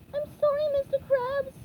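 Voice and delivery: calling down. A narrator's reading. SpongeBob calls down to Mr. Krabs from the bubble, high calling voice. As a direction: call it down apologetically. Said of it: "called down".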